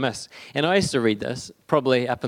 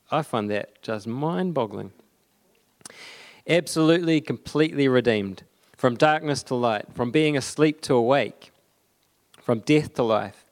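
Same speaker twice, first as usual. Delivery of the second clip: ordinary delivery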